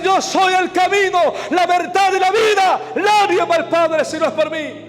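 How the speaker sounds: preacher declaring the verse at full force; preaching shout. Acted. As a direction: cry it out to the whole church. Preacher quotes John 14:6.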